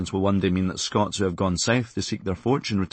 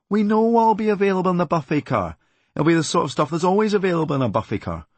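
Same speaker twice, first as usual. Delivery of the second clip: loud, much higher, fed-up outburst; exasperated rant; 8 semitones higher, 4 dB louder than usual. Retold or imitated. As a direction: snap back at the tannoy. Ranting back at train announcements.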